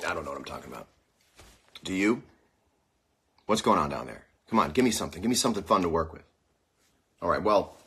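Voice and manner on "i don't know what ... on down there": ordinary talk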